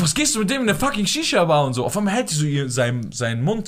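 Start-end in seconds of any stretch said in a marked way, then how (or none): 0.0-1.6 high-pitched indignant questioning; exasperated, high quoted outburst; indignant retort. Retold or imitated. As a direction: confront them in mock outrage, pitched high. Retells confronting someone indignantly.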